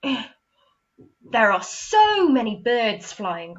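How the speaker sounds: slow, loud wonder at the birds; amazed exclamation. Shown as a demonstration. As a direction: marvel at it slowly. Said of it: "Exclamation mark".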